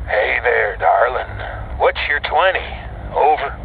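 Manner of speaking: distant gruff trucker on radio; over CB radio. Acted. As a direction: talk through a crackly CB mic.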